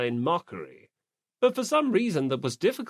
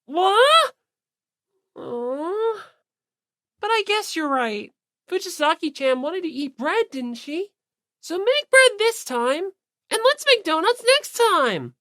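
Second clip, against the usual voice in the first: put-on high, bright girl's voice, dipping then bouncing back; pout, give in, then perk right up; bubbly Asahina; 14 semitones higher, 6 dB louder than usual. Asahina conceding to Ogami, high pout turning cheerful.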